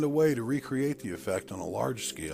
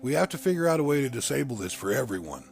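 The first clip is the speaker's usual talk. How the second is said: loud, forceful, higher-pitched protest; stern; 6 semitones higher, 8 dB louder than usual. A narrator's reading. Narrator voicing a controlled man demanding freedom for everyone, sternly. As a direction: press the objection firmly, voice raised. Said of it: "I said sternly".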